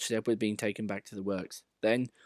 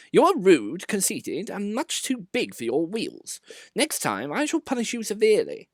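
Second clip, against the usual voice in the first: severe; 10 semitones higher, 3 dB louder than usual. A narrator's reading. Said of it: "was severe with Sir Handel".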